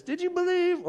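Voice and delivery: very high, squeaky question; exaggerated high-pitched asking; demonstrated reading. Shown as a demonstration. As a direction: ask it high up in falsetto. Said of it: "A, A, this one right here".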